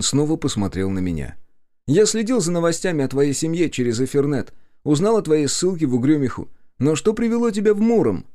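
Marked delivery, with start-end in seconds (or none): 1.9-8.3 high, louder cousin's voice; high character voice rising into a question; inquisitive cousin. A narrator's reading. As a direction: voice a long-lost relative wanting answers, pitched up.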